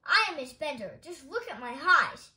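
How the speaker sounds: irate patient; indignant complaint. A narrator's reading. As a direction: show off your ailment in outrage. Angry patient confronts Amelia.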